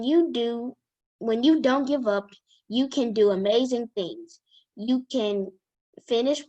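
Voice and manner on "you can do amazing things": ordinary delivery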